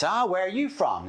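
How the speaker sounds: high-pitched mock American accent; American stranger, voice lifted high. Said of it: "Do you like my American accent?".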